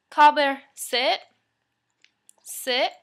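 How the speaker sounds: loud sharp call to sit; firm dog commands. Real, not acted.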